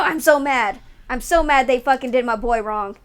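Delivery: ordinary talk, nothing marked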